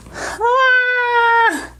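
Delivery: high sudden yelp; startled cry. Real, not acted. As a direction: cry out in surprise. Mascara flicked onto lid.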